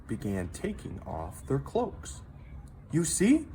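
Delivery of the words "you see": high, louder cheerful announcement; excited cry. A narrator's reading. Gilda announcing baths to the hall, high and cheerful. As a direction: proudly show off the hot baths. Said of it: "cried Gilda".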